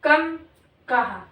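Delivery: normal talking voice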